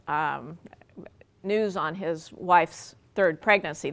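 usual speaking voice

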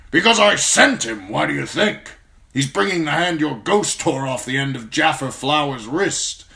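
put-on a gruff old commander praising a young man; gruff old lord commander. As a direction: speak as the stern old Lord Commander, grateful but brusque. Mormont voice.

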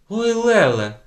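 high, loud cry of alarm; shocked shriek. A narrator's reading. Teacher shocked by the girl's answer, shriek. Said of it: "зойкнула вчителька".

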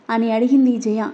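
plain reading voice

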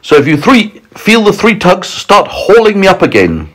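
high, emphatic instructions; crying out. A narrator's reading. James telling friends to haul him up, crying out. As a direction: call out each step clearly. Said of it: "cried James".

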